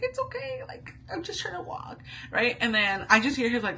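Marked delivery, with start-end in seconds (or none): 0.0-2.0 very high, thin imitated weepy voice; mock crying. Telling grandpa she's only walking, mock tearful whimper. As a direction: squeak it out through pretend sobs. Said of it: "Like, literally crying".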